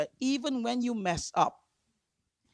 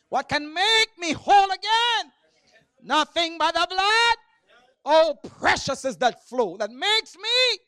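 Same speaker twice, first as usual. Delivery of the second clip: high, shouted revival preaching; exultant full-force preaching; 5 semitones higher, 3 dB louder than usual. Real, not acted. Preacher rejoicing over cleansing from sin, exultant shouted preaching. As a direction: proclaim the hymn words in rising shouts.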